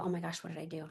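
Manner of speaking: soft, dropped voice; hushed startled thought. Retold or imitated. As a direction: think it aloud under your breath. Retells own startled inner thought.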